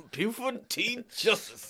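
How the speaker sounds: drawn-out movie quote. Retold or imitated.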